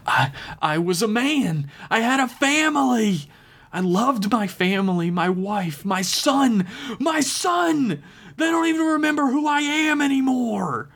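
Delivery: high, anguished cry; desperate ranting. Acted. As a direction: rave about the lost family, near breaking. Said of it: "the man screamed and ranted".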